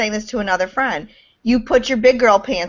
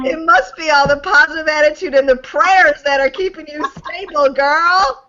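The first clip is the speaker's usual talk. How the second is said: wise-ass sarcasm; 6 semitones higher than usual. Pretend. Friend teasing about prayers keeping her stable, sarcastic. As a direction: lay the sarcasm on thick. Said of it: "know my sarcasm".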